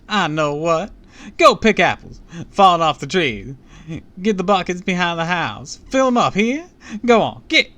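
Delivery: high, booming Southern belle; chipper Southern belle. A narrator's reading. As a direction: boom it out as a very chipper Southern lady. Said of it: "let's give her the Southern".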